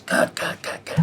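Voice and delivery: rapid-fire mock shout to stop the take; imitated master's shout. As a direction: imitate a master calling a halt, voice rough and toneless. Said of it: "my master shouts".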